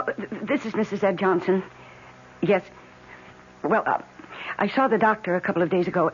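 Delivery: usual speaking voice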